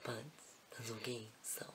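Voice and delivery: soft embarrassed whisper. Real, not acted. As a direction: murmur it sheepishly. Embarrassed asides after the act.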